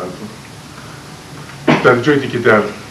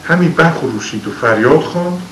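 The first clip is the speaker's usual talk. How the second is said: poem recitation; 5 semitones higher than usual. Acted. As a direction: recite it like old epic poetry. Recites Shahnameh verse. (خوانش حماسی شعر)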